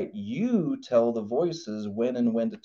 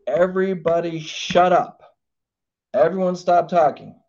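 raised commanding voice; 5 semitones higher, 6 dB louder than usual. Shown as a demonstration. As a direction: take charge and silence everyone. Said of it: "elevate my voice and say".

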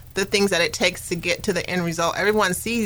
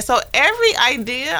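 ordinary talk, nothing marked

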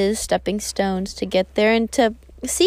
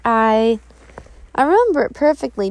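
ordinary delivery

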